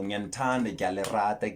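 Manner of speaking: normal talking voice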